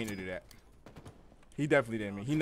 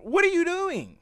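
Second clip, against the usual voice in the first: exasperated yell; 8 dB louder, slower than usual. Real, not acted. Gamer yells at a teammate.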